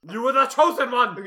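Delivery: loud, high, strained cry; shouted movie quote. Retold or imitated. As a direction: belt it like a heartbroken Jedi. Quoting Obi-Wan's famous line.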